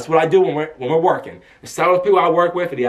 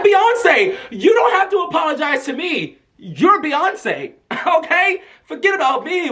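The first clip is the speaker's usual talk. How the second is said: high-pitched fan insistence, acted out; gushing reassurance; 11 semitones higher, slower than usual. Fan telling [name] not to apologize, gushing. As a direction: reassure the star in a starstruck squeal.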